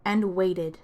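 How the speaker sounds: put-on lively read-aloud for kids; storytime narration. Librarian reading a picture book to kids, lively storytime voice. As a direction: tell it to a room of children.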